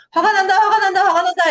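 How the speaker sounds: high, urgent repeated outburst; mock rising anger. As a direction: act out an emotional brain flaring up. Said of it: "감정을 다루고 있습니다".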